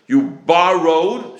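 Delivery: "borrowed" drawn out slowly and hit hard; stress on borrowed. Shown as a demonstration. Teacher explaining a loan's obligation, "borrowed" heavily stressed. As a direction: lean long and loud on "borrowed". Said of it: "stressing the word".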